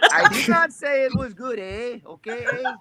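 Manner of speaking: high, badly faked Canadian accent; hammed-up Canadian "eh"; mock Canadian accent. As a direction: play up the bad Canadian accent in protest.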